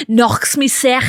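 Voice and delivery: Scouse accent, throaty end on sick; Scouse catchphrase. Shown as a demonstration. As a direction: savour the Liverpool sound. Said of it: "Scouse is one of my favourite accents".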